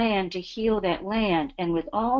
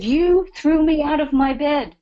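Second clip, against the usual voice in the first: high, loud, excited retelling of the landowner; loud mock indignation from the woman woken from bed; excited French woman; 8 semitones higher, 5 dB louder than usual. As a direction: burst out like the startled French woman. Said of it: "And she said".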